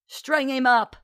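high-pitched rallying call; goading cry. A narrator's reading. Millicent goads the others, high rabble-rousing cry. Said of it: "Millicent goaded the others".

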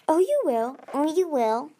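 smug. A narrator's reading. Whirlpool smug promise.

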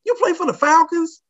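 high called-out question; retold yell from the bus. Bus guy shouting up to him, high calling voice. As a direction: call it up the driveway from the bus. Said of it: "another guy yells".

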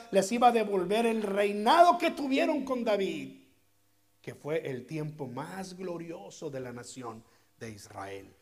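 ordinary delivery

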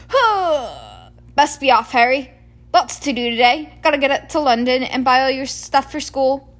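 booming cheerful giant ready to go; Hagrid the giant. Acted. Hagrid voiced in story.

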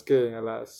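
ordinary talk, nothing marked

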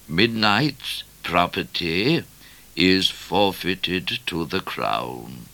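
very slow, measured proclamation; official notice. A narrator's reading. Notice nailed to Midnight's door, slow official reading. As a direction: read it out word by word.

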